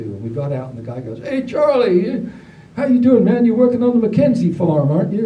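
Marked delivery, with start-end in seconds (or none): 1.2-5.3 loud, high country greeting; hearty neighbour. Retold or imitated. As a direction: boom out a friendly country hello. Neighbour greeting voiced in story.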